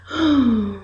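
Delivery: long demonstrative sigh; sad sigh. Shown as a demonstration. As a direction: let the sigh sink out sadly. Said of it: "You sigh, you go".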